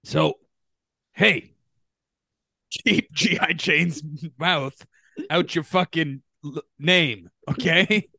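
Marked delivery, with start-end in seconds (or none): 1.1-8.1 angry threat. Pretend. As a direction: warn him off, heated. Will Smith style threat at Oscars.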